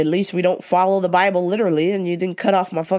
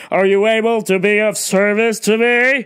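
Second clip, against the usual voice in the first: loud, demanding customer call; imitating the pushy shopper demanding service, loud; entitled customer; 3 semitones higher, 6 dB louder than usual. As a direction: snap for attention like you're owed it. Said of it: "And she's yelling".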